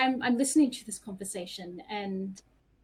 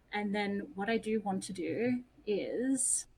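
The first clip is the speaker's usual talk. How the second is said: normal talking voice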